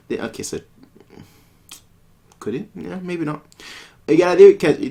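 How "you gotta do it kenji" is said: louder, higher insistence; pushier urging. Acted. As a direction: press him harder. Urging Kenji again, louder.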